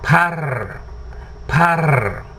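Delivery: rolled purr sound, drawn out; purring kitten. Shown as a demonstration. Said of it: "practice makes perfect".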